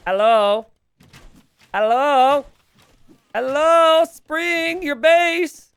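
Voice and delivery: high, loud drawn-out hollers; playful high-pitched shouting to get attention; calling out. Pretend. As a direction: yell for someone who isn't paying attention. Calling out to inattentive player Spring.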